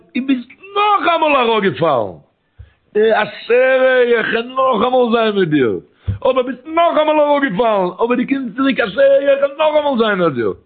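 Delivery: high, wailing chanted outcry; crying out. Retold or imitated. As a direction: retell a holy man's scream as a long, impassioned wail. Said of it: "man hat geschrien".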